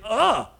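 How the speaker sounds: loud, high groan of realization; dismayed "ugh". Acted. Preacher voicing people discovering their sin, loud dismayed groan. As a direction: blurt out a sudden acted "ugh".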